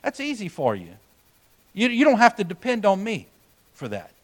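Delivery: quiet, knowing and fond; gentle voice of God. Retold or imitated. God gently telling the preacher he's too comfortable, soft and fond. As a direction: hush it like a patient father. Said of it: "And God says".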